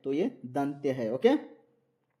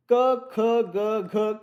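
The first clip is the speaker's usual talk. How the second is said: slow drawn-out recitation; 4 semitones higher, 4 dB louder, much slower than usual. Shown as a demonstration. Teacher reciting letters slowly.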